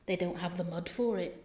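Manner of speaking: soft West Country mum; quiet, rustic West Country reply; West Country accent. Acted. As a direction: explain it gently in West Country dialect. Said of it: "it's West Country".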